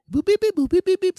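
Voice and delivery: high, loud imitated dial tones; phone dial beeps. Dialing from friend's house, squeaky beeps. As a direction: beep out a number in falsetto.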